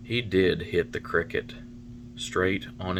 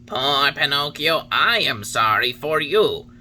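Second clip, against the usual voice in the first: higher, louder solemn cricket warning; Talking Cricket warning; 6 semitones higher, 5 dB louder than usual. A narrator's reading. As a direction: preach it like a wise old insect. Cricket pities Pinocchio.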